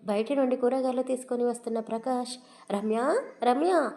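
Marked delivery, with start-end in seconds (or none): 3.4-3.9 louder, higher call of the name. A narrator's reading. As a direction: shout the name across the house. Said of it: "అని అరుస్తూనే లోపలికి వచ్చాడు". (భార్యను గట్టిగా పిలవడం)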